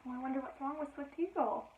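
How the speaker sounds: ordinary delivery, nothing marked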